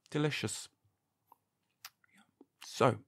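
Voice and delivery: usual speaking voice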